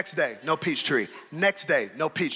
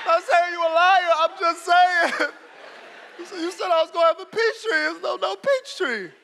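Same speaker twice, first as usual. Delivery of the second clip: put-on higher, pleading little-boy voice; a child squeaking out excuses to his dad; whiny child; 12 semitones higher, 3 dB louder than usual. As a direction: backpedal fast like a scared kid. Child backpedaling to father.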